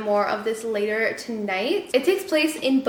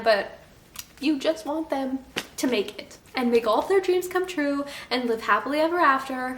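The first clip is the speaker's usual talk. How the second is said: ordinary talk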